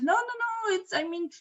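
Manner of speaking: high, protesting backpedal; acted-out high-pitched protest; flustered denial. Culprit hurriedly denies to the driver.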